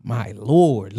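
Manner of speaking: normal talking voice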